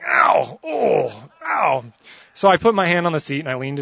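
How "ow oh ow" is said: high, loud yelps of "ow"; mock pained ow. Tall passenger getting his knees hit, mock pained yelps. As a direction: yelp with each bump, comically. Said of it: "I'm just going".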